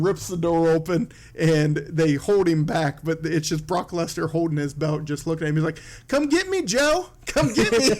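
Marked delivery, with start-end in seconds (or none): 6.1-7.9 high-pitched imitated goading; mock taunt. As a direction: call him out in a high, taunting voice. Brock taunting Joe, belt held up.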